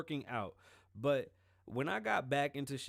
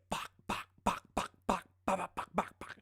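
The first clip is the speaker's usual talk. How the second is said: imitated gunfire; 6 semitones higher than usual. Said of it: "That motherfucker said".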